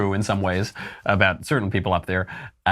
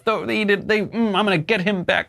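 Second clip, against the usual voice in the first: higher, hammed-up vow of payback; mock angry grudge; 10 semitones higher than usual. Imagined narcissist vowing to get a critic back, in mock anger. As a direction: play a petty narcissist swearing revenge, mock angry. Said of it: "gets really angry and says".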